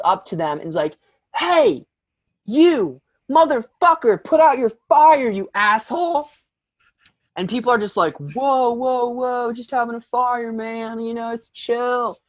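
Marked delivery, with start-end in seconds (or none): 1.3-6.3 high, loud mock-angry yell; hammed-up belligerent shouting. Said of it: "she's, like, more angry now". 8.2-12.2 high-pitched, laid-back hippie imitation; mock mellow voice, pitched up; mellow camper. Imitating mellow campers calming her down.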